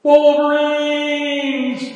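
long, high, loud yell; battle cry. Acted. Mock patriot yelling the battle cry. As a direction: hold out a rousing war whoop.

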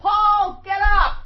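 high, loud holler up the stairs; yell upstairs. Retold or imitated. Host re-enacting waking a studio guest, yelled. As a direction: bellow to wake someone upstairs. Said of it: "I just yelled upstairs".

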